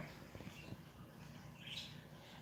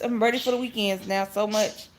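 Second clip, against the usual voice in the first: usual speaking voice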